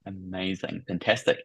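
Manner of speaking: ordinary talk, nothing marked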